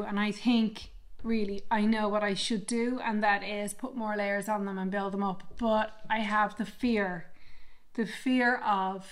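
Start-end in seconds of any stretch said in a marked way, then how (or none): none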